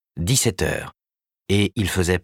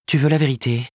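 higher, businesslike woman's voice; lightly voiced Karen, brisk agent; 7 semitones higher than usual. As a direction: report the news briskly.

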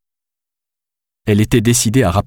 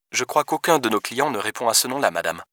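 soft, higher-pitched phone greeting; polite hotel receptionist; 3 semitones higher, 6 dB quieter than usual. Acted. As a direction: greet a caller with professional politeness.

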